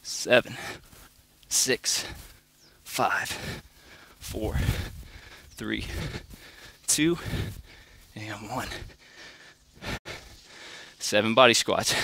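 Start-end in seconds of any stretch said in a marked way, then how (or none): 0.0-8.7 quiet, strained counting mid push-up; counting workout reps. Real, not acted. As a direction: count reps under your breath while working. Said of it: "I'm gonna count it out for you too".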